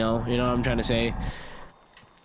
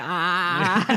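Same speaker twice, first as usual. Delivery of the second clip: imitated concert scream; 2 semitones higher than usual. Podcaster teasing co-host about ringing ears, mock scream. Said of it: "You sit at a concert like".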